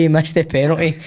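normal talking voice

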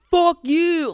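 put-on shouted high and loud like an angry teen commenter; mock yell. Mimicking teen commenters yelling in the comments, loud mock shout. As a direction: bark it like a troll in the comments. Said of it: "nineteen-year-olds being like".

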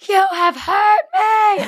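put-on very high, slow, wounded child voice; dramatic child. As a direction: overdo a hurt little girl's complaint. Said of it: "she was like".

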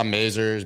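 "Mazars" said as MAY-zers; "Mazars" rhyming with lasers; alternative pronunciation. Shown as a demonstration. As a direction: say "Mazars" with a long A, stressed first. Tries three pronunciations of the name.

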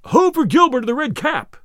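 high, loud cheer for Gilbert; crowd cheer. A narrator's reading. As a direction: call it out to the archer. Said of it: "some crying".

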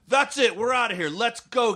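high-pitched mock rallying call; rally the guys like an indignant heckler; storming out. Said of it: "the feel of it was kinda like".

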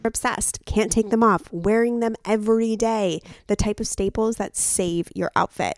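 loud podcast ad delivery; ad read. Acted. Co-host recommending sweaters to listeners, emphatic ad read. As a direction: push each product detail with polished energy. Reads a sponsor ad.

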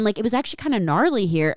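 normal talking voice

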